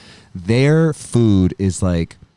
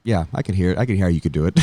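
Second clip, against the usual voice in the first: normal talking voice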